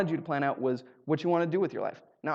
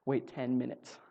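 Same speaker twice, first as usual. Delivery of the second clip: ordinary talk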